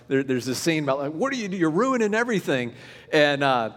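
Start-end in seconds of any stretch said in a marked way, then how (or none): 1.1-2.7 high-pitched exasperated complaint; hammed-up irritated outburst; grumpy guy. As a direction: complain like a put-upon grump. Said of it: "kind of this grumpy guy".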